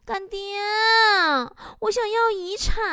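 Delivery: put-on high, babyish wheedling voice; very coquettish. Goddaughter clinging to her sugar daddy, mock coquettish wheedle. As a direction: coo it up high, hammed-up sweetie act. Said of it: "用非常嗲的声音说".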